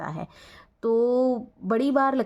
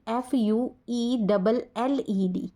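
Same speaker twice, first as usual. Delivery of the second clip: slow spelling out; much slower than usual. Shown as a demonstration. Teacher dictates the British spelling slowly to students. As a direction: spell F U E double L E D slowly, letter by letter. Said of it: "ये British होगा".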